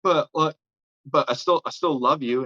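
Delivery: high, hurt, imitated stammer; mock a stunned kid's pleading; stunned fan. Stunned kid to the rapper who told him off, high imitated plea. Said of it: "never seen a kid look so stunned".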